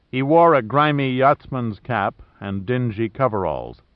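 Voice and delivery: ordinary delivery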